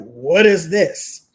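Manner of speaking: loud, drawn-out mock dismay; mock disbelief. Speaker recalls reacting to parents' divorce, mock disbelief. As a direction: stretch it out in playful outrage.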